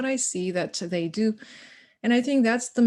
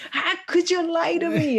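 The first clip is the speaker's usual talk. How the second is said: high, loud, outraged accusation; raised angry voice; 10 semitones higher, 4 dB louder than usual. Retold or imitated. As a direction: confront him, voice climbing high in anger. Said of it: "I am raising my voice".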